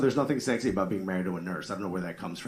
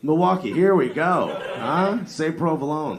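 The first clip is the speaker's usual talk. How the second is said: louder, higher, hammed-up revulsion; playful theatrical grossed-out rant; mock disgust; 6 semitones higher, 6 dB louder, slower than usual. Real, not acted. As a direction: groan in fake disgust. Mock disgust at Milwaukee provolone.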